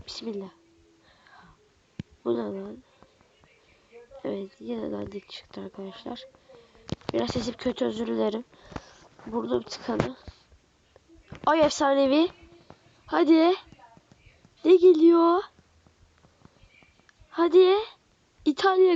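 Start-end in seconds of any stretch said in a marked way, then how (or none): 0.1-0.5 soft hushed Bismillah; whispered prayer. Real, not acted. Praying before pack reveal. 11.5-18.9 higher and louder, urging the card; hyped pack opener; excited shout. Real, not acted. Legendary pack reveal building up.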